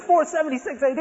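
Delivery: high disbelieving echo; incredulous echo. Retold or imitated. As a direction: repeat it as if you can't believe it. My goodness.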